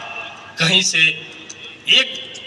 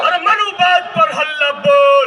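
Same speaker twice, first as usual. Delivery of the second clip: shouted slogan; 10 semitones higher than usual. Acted. Leader rouses the rally crowd, shouted slogan chant. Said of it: "इतना जोर से हल्ला बोलना होगा".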